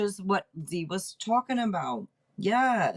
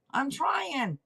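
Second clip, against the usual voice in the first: usual speaking voice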